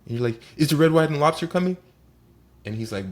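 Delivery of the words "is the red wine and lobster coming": high, loud mock-needy question; pestering question. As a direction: nag the waiter in a raised, put-on voice. Diner pestering annoyed waiter again.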